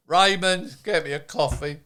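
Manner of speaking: loud, high bossy order; bossy demand. Retold or imitated. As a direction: bark it like a demanding boss. Boss barking order at Raymond.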